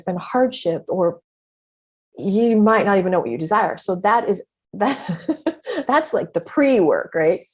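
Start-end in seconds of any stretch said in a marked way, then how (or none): 5.0-5.8 a soft high embarrassed chuckle; light laugh. Real, not acted. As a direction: laugh at yourself tripping on words. Laughs at own stumble.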